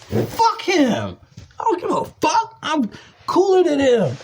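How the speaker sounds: high-pitched, indignant brush-off; worked-up, boastful defiance; defiant dismissal. Real, not acted. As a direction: dismiss him with your voice riding up. Dismissing coworker, bragging.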